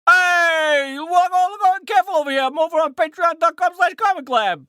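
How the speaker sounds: loud, high New Yorker caricature; New York accent. Shown as a demonstration. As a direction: holler it like a put-on New Yorker. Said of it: "New Yorker".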